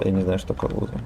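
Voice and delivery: ordinary delivery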